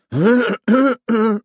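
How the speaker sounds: loud, pointed throat-clearing; emphatic warning ahem; loud cough. A narrator's reading. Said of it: "Igor coughed loudly".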